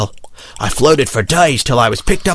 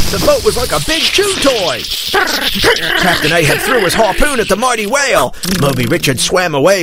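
usual speaking voice

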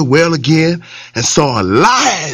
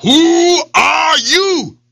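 growled; 10 semitones higher, much slower than usual. Acted. Lion shouts down into the well, growling. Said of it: "he growled down".

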